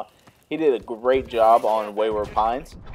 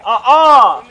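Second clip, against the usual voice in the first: high, loud mock uh-uh with a laugh; loud refusal; 12 semitones higher, 14 dB louder than usual. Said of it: "And they're like".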